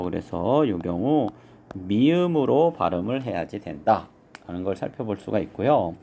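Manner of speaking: ordinary talk, nothing marked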